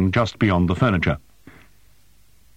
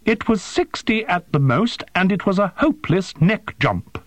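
high-pitched, scornful fury; incensed drone voice; spitting with fury; 12 semitones higher than usual. A narrator's reading. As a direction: spit the correction out furiously, pitched high. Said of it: "vocal impression of a human spitting with fury".

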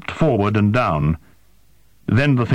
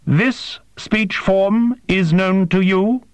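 higher, slow and formal alien voice; slow alien interpreter; 11 semitones higher, slower than usual. A narrator's reading. Interpreter asks the human again, slow and formal. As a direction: ask it slowly and precisely.

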